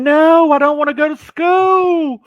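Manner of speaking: high, drawn-out mock wail; big hammed-up howl of refusal; inner protest wail. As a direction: wail it loud like a protesting child. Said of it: "that's what I'm thinking on the inside".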